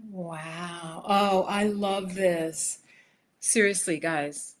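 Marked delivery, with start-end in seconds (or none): none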